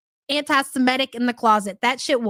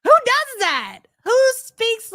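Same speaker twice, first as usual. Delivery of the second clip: high-pitched, exasperated disbelief; incredulous outburst; 11 semitones higher, 4 dB louder, slower than usual. Real, not acted. Streamer reacting to a played clip, high incredulous outburst. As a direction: cry it out in baffled disbelief, pitched way up.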